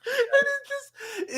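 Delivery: high, slow squeak of laughter; the words squeeze out through a laugh; laughing squeal. Real, not acted. Cracking up over a movie moment with friends, squealing laughter.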